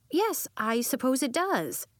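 measured, patient teacher. Acted. Teacher voiced in story.

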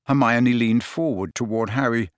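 ordinary delivery

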